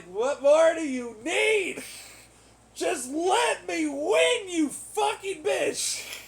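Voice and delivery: slow, high, yelling in raw frustration; drawn-out enraged yell; furious shout. Real, not acted. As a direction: scream it out, pleading and furious. Frustrated rant at imagined opponents.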